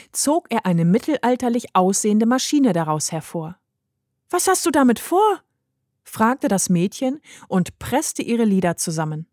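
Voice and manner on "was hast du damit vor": put-on high, apprehensive child's question; alarmed little girl. A narrator's reading. Heidi eyes grandfather's machine, alarmed. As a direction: shoot the pitch up as the girl.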